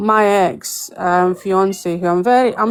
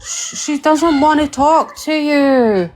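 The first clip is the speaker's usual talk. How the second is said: higher, slow coo at the cat; pet voice; 8 semitones higher, 3 dB louder, slower than usual. Acted. Owner tells the cat her missing friend won't talk, high slow coo.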